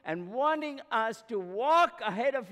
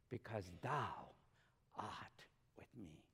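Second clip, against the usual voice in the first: soft whisper; 11 semitones lower, 15 dB quieter than usual. Acted. Quoting the psalm intimately.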